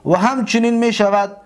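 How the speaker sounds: ordinary delivery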